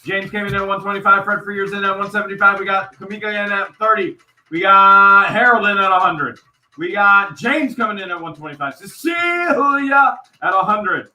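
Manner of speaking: high, loud live bid calling; excited auction announcer, projecting over the room; auctioneer call. Real, not acted. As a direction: rattle off the bids with rising excitement.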